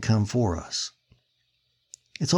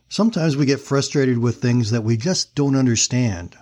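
ordinary talk, nothing marked

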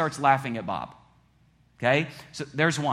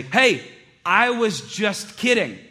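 higher, louder excuse, drawn out slowly; defensive protest; 5 semitones higher, 5 dB louder, slower than usual. Retold or imitated. Preacher's past self, called out on jokes, protesting defensively. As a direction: brush off the criticism with mock innocence.

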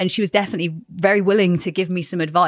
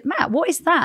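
much higher, startled question; mock astonished ask; retold surprise; 10 semitones higher than usual. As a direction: recreate the moment she spotted them.

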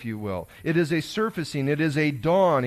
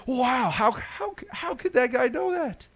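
much higher, slow, astonished wow; amazed; 10 semitones higher, slower than usual. Pretend. Imagined church member finding the hawk man, astonished. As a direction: gasp at the impossible. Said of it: "you say".

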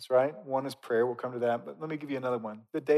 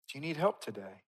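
softer, with a falling end on TODAY; soft repeated question; 6 dB quieter than usual. Real, not acted. Preacher gently asks the congregation, soft falling question. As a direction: ask it quietly, letting TODAY fall.

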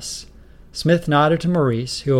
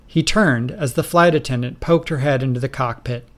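plain reading voice

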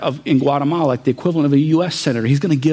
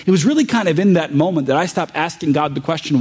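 usual speaking voice

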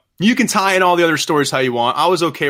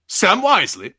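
raised-pitch disbelieving question; incredulous Lucifer; 6 semitones higher than usual. Acted. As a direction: repeat the name as if you misheard. Lucifer repeats name, disbelieving.

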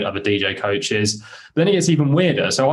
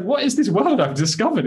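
amazed; 11 semitones higher than usual. Retold or imitated. Amazed at VR world.

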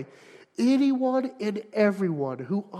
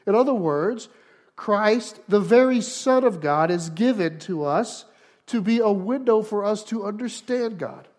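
louder, higher, slowing on key words; rising preacher's cadence, punching each phrase; fervent preaching; 4 semitones higher, 6 dB louder than usual. Acted. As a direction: drive the point home from the pulpit. Preaches at full force.

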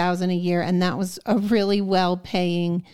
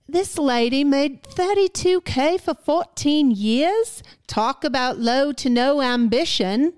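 higher, slow put-on Cockney; exaggerated Cockney, vowels stretched; mock Cockney; 6 semitones higher, 4 dB louder than usual. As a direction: read the comment in hammed-up Cockney. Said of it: "how do you say Debbie in Cockney".

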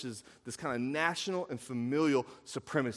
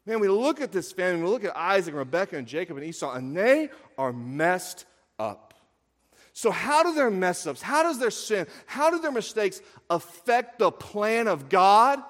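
raised, emphatic preacher voice; forceful preaching; 8 semitones higher, 10 dB louder than usual. Acted. Preacher listing Jacob's sins to the congregation, forceful. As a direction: hammer out each offence, high and driving.

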